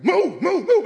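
loud, high repeated command; pastor's order. Retold or imitated. Old pastor pushing him, loud. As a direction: drive them forward. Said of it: "He used to talk to me like".

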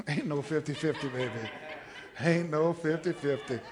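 laughing. Real, not acted. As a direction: laugh it off to the crowd. Laughing at the show of hands.

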